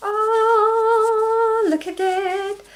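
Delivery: high, loud gasp then awe; showing viewers the yarn, gasping in delight. Real, not acted. Unveiling the first skein.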